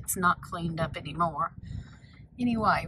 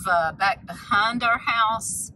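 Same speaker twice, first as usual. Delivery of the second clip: ordinary delivery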